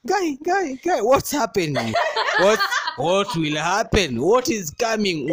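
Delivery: high, frantic mock panic; hammed-up high panic; panicked. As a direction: squeal it like a scared guy. Panicked inner voice, pregnancy scare.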